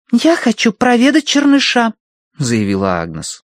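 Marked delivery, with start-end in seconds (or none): 0.1-1.9 put-on high, loud child voice; little girl voice. A narrator's reading. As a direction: speak like a demanding little girl.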